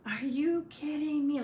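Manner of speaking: high, slow mock outrage; retold losing it. Mom at the water mess, retold exasperated outburst. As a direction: stretch it out in imitated disbelief and fury. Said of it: "I just lose my shit".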